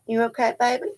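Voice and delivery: soft, high, tender question; concerned pet talk. Real, not acted. Owner asking her huffing dog, tender and concerned. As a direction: coo gently to a dog.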